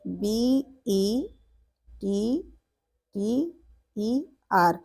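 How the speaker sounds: slow letter-by-letter spelling; teacher spelling most letter by letter; spelling aloud. Shown as a demonstration. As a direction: spell it out slowly for students. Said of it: "वो है better".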